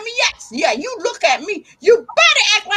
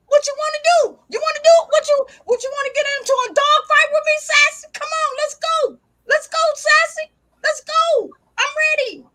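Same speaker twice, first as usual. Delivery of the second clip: higher, playful come-and-get-it taunts; mock fight taunt; 8 semitones higher than usual. Owner daring her dog to a dog fight, high playful taunt. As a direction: egg the dog on like squaring up.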